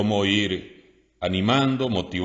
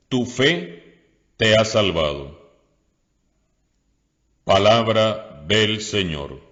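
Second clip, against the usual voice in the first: measured, solemn reading from the lectern; liturgical reading; 2 semitones higher, 4 dB louder than usual. Acted. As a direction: proclaim it to the congregation. Reads the Gospel at mass.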